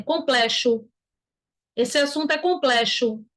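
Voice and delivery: COMPLEXO mispronounced as "com-ple-shu"; wrong CH pronunciation. Shown as a demonstration. Teacher showing what nobody here says, deliberately mispronounced. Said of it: "Ninguém aqui fala complexo".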